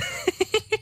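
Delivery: high breathy gloating giggle; mocking cackle. Demon mocks frozen Heinrich, high giggle. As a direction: giggle at him cruelly. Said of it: "Hör auf zu lachen".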